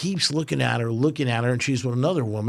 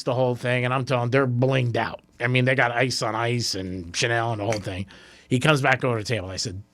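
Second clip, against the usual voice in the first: ordinary delivery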